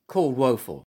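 plain reading voice

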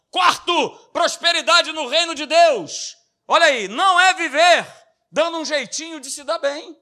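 loud, high pulpit preaching; fiery preaching. Acted. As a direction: preach it out with full force. Preaching at full force.

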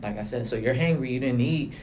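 normal talking voice

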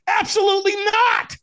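high, shouted flat-out refusal; acted high-pitched shout of protest; emphatic refusal. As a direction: exclaim it as an outraged mock refusal. Retelling his emphatic refusal to walk.

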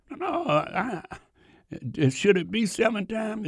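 incredulous question. Acted. Peter questioning the seven times.

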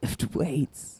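breathy whispered gym chant; hushed pump-up whisper; gym bro whisper. Pretend.

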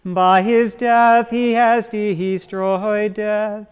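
ordinary talk, nothing marked

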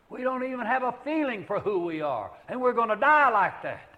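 high, loud fervent shout; loud preaching cry. Real, not acted. Preacher crying out to the congregation that we die not knowing ourselves, loud and high. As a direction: cry it out over the whole room.

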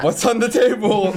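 high, loud mock question through laughter; acted retold question. Player retelling a first game question, high-pitched and laughing.

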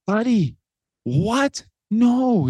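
high-pitched, incredulous protest; retold shocked dad, voice leaping up; startled disbelief. Dad reacting to his son's apology, startled disbelief.

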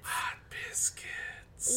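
slow, breathy whisper of hot biscuits; mock swooning whisper. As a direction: whisper "Hot biscuits" slowly, as if it is a treat you crave. Echoing menu as a whisper.

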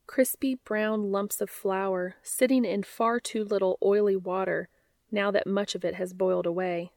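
plain reading voice

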